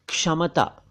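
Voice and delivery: क्षमता said in three syllables, "ksha-ma-ta"; correct ksh onset. Shown as a demonstration. Teacher giving the right form: क्षमता as "ksha-ma-ta". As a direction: say क्षमता with k before sh and middle vowel. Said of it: "आपको कहना चाहिए".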